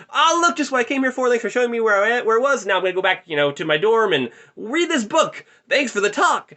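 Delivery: loud, high, hammed-up false cheer; forceful exit. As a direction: blurt loud thanks to escape a conversation. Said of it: "exited the conversation fairly forcefully".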